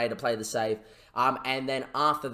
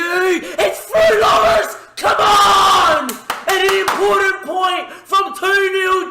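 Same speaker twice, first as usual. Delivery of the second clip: much higher, much louder goal celebration yell, slow and stretched; ecstatic shouting; 10 dB louder, slower than usual. Real, not acted. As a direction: scream the late equaliser like a lifelong fan. Late equaliser, he is out of his chair.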